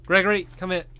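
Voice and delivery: high call to the dog; calling a lost pet. Real, not acted.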